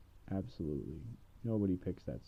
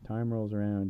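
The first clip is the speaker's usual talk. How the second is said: normal talking voice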